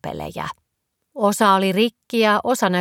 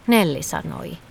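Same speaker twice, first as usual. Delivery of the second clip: ordinary delivery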